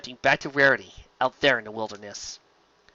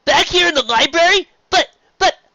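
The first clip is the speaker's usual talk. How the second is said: much higher, loud alarmed protest; Spike protesting; 9 dB louder than usual. Acted. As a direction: protest that he's coming too.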